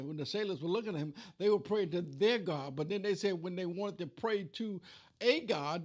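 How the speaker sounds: ordinary delivery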